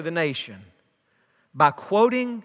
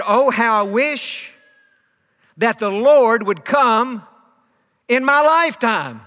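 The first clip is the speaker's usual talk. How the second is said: slow, louder wish that swells; fervent longing; 3 semitones higher, 4 dB louder than usual. Retold or imitated. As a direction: voice a heartfelt longing, drawn out. Queen's fervent wish, retold.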